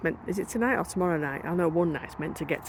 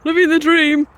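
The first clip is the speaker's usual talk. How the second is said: passer-by voice; 9 semitones higher, 10 dB louder, slower than usual. Retold or imitated. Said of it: "they go".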